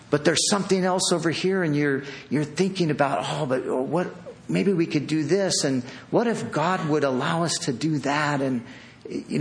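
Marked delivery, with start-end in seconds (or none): none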